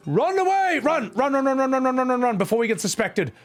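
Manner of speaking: high, loud panicked run cries; being chased. Pretend. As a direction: yell it like you're fleeing. Fleeing after pickpocketing.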